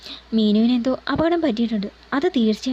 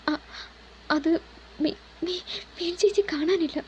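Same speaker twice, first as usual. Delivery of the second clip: frightened stammer; 5 semitones higher, 5 dB quieter than usual. Acted. Frightened girl stammers bad news.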